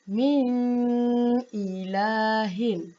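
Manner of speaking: min ilahin, nun hummed long through the nose; very slow, hummed nun; the mistake to avoid; wrong nasal reading. Shown as a demonstration.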